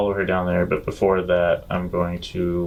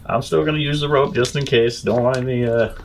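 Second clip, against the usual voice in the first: lightly voiced cautious companion, pitched up; character voice; 5 semitones higher than usual. Companion telling the Loxodon about the rope, light higher character voice. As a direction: insist on the rope, a bit higher and careful.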